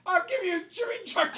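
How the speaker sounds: loud, high grandfather outburst; mock-threatening shout; imitated angry threat. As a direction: yell it as the fed-up grandfather.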